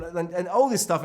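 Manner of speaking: ordinary talk, nothing marked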